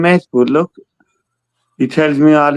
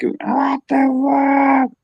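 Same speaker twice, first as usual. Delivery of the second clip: drawn-out, much higher mock shock; shocked what the fuck; 10 semitones higher, 4 dB quieter, much slower than usual. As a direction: stretch it out, way up high, stunned. Mock shocked reaction in story.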